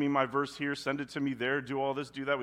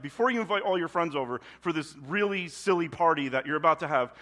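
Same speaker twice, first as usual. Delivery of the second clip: louder, exasperated God voice; God cutting off a silly party, louder; 3 semitones higher, 7 dB louder than usual. Acted. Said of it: "And God's like".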